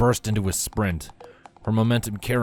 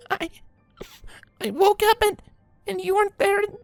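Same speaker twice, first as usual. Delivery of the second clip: much higher, stammering filly voice on the edge of crying; choking back tears; 3 dB louder than usual. Acted. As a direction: force the words out between sobs. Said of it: "Scootaloo choked out".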